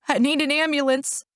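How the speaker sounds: anxious emergency call; distressed caller. A narrator's reading. Emergency call after shooting.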